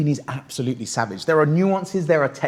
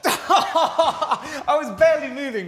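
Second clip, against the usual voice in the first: laughing; 9 semitones higher, slower than usual. Real, not acted. Laughing after the drill.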